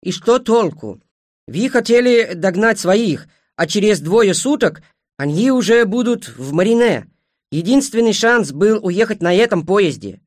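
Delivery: high, loud, drawn-out protest; exasperated clanswoman. Acted. (с досадой, высоко и громко)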